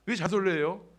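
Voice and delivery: ordinary talk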